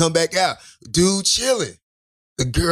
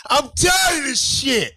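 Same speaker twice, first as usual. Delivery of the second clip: fed-up captain; 6 semitones higher, slower than usual. Retold or imitated.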